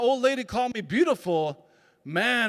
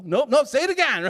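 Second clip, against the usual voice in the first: louder and higher, pushing; insistent; 7 semitones higher, 6 dB louder than usual. Retold or imitated. Self in the mirror demanding a retake, loud and insistent. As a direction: demand another take.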